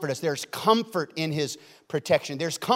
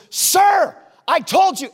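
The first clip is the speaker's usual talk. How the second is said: mock furious high shout; upset pastor; 12 dB louder, slower than usual. Pastor losing patience with man walking out, upset shout. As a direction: explode from the pulpit at being ignored. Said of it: "he gets upset on the platform".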